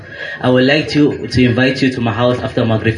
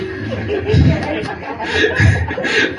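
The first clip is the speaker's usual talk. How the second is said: a breathy high snicker; sly high laugh; 3 dB quieter than usual. Acted. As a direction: laugh at your own prank. Host laughs at his trick.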